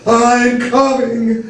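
slow, high, resigned wail; doleful, drawn-out call; mournful wail. A narrator's reading. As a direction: draw it out mournfully. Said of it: "the mournful, resignated note".

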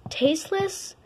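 ordinary talk, nothing marked

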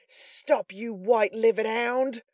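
high, loud shout after a fleeing man; angry shout. A narrator's reading. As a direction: yell it out slowly at the runaway. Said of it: "cried the robber, shouting after Toby Crackit".

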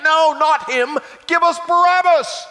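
loud, high-pitched yell of the crowd; crowd shout. Acted. The crowd shouting back at Pilate, loud high yell. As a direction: shout it back as an angry mob. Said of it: "But they shouted back".

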